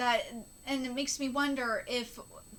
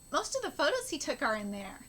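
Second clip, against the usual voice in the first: normal talking voice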